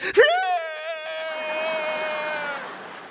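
long high-pitched drawn-out squeal; comic imitated slow-motion wail; slow punch noise. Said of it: "This is real speed".

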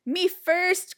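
high, loud eager shout; eager cry. A narrator's reading. Pinkerton crying out for the sandwich. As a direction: claim it first. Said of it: "cried Pinkerton".

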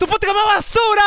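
hyped podcast slogan cry; catchphrase shout. Acted. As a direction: belt out the show's tagline.

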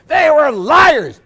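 slow, high, loud declaration; exasperated shout. Retold or imitated. Priest finally answering the woman, drawn-out shout. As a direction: blast it out word by word. Climax of the retold argument.

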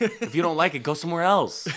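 high, loud and exasperated; fed-up retort. Real, not acted. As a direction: snap it at her like she's in the room. Telling off the heckler lady.

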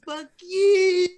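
high mocking shout of fuck you; bratty kid yelling from a limo; imitated taunting yell. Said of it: "as he was saying".

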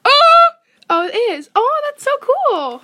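very high, loud delighted gasp; amazed squeal. Real, not acted. Amazed at recording light.

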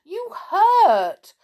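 very high, loud pained protest; yelp, then scold crossly. A narrator's reading. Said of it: "getting cross".

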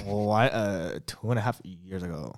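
slow, low, unimpressed drawl; mock his deadpan sass; sassy boyfriend voice. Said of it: "when you do my voice, it's like".

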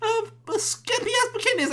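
high, loud, far-off mock girlfriend yelling; girlfriend's voice. Girlfriend scolding Robert over bikini game, high mocking shriek. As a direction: shriek it like an outraged girlfriend catching him. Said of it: "she's like".

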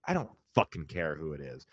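usual speaking voice

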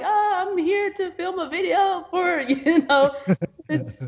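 high, wavering mock-nervous stammer; retold breaking voice. Videographer explaining herself at a stranger's door, voice breaking. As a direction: squeak it out haltingly, imitating your nervous self. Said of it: "my voice is breaking and I'm like".

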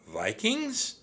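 very high, rising echo question; startled question. A narrator's reading. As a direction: squeak it back in disbelief. Annie startled by the news.

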